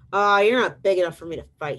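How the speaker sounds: loud, quick, dismissive brush-off; grouchy ladybug. Acted. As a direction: brush them off like a grouchy bug. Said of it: "the grouchy ladybug".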